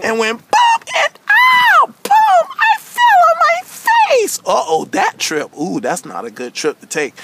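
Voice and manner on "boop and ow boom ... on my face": high-pitched mock cries, slowing at the end; cartoon yelps of pain; hammed-up tumble. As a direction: squeal out each bump of the fall. [name] acts out her fall.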